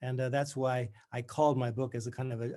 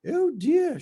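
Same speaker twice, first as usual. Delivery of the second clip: much higher, louder, strained bright Scottish schoolmistress; strangulated faux brightness; 10 dB louder than usual. A narrator's reading. Tutor greets her old student, strangulated and falsely bright. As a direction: push it through gritted teeth with forced cheer. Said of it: "In her strangulated voice".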